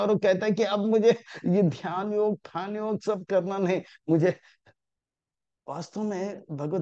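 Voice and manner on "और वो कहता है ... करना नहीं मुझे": घबराया Arjun. Retold or imitated. घबराए अर्जुन की नकल.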